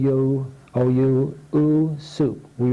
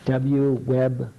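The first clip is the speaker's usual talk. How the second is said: ordinary delivery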